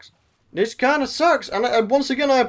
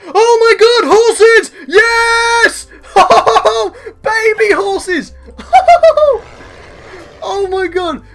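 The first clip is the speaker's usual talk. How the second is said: much higher, much louder joyful yelling with laughter; overjoyed shout; 10 semitones higher, 10 dB louder, much slower than usual. Real, not acted. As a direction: scream with delight at the find. Finally finds horses in game.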